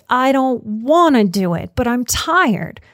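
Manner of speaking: put-on petulant little kid listing excuses; whiny inner child. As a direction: whine like a resistant child who won't try. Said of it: "the child looks at a dilemma and goes".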